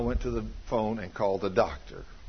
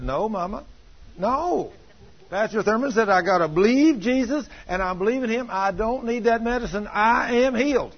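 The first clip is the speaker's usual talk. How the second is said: put-on high-pitched, insistent little boy; acted child's high, emphatic protest; imitated defiant child; 8 semitones higher than usual. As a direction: plant your feet as a small boy refusing Mama. Boy defiantly refuses medicine.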